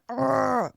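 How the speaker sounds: mock fed-up ugh; frustrated groan. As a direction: groan like you've just been refused. Just being like.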